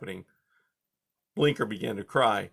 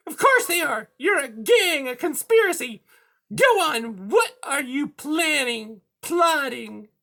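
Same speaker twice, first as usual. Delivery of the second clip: high, loud accusing villain; high-pitched angry cat; 6 dB louder than usual. A narrator's reading. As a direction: hurl accusations in a piercing voice.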